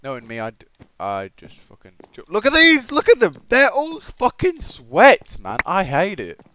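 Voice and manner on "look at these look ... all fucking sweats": sudden high, loud rant, slowing on "sweats"; exasperated outburst. Real, not acted. Gamer ranting about tryhard opponents, exasperated outburst. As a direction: burst out at the sweaty players.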